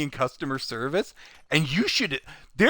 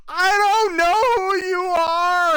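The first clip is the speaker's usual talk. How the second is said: high, drawn-out wail mimicking her; long, high mock scream; mocking shriek; 12 semitones higher, 4 dB louder than usual. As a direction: throw the shriek back in mockery.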